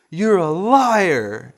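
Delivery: high, loud, drawn-out outrage; indignant mock accusation. Little brother confronting his lying sibling, indignant. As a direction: stretch it out like a wronged kid brother.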